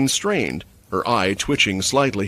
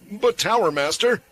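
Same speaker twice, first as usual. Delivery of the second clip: protesting; 10 semitones higher than usual. A narrator's reading. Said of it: "one guard protested".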